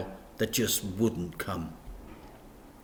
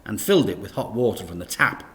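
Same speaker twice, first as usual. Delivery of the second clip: ordinary delivery, nothing marked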